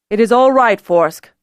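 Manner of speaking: loud reassuring call to the dragon. A narrator's reading. Lord Holder calls to his dragon in her lair, loud and steady. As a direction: call across the yard to calm her. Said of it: "Beeman called to her".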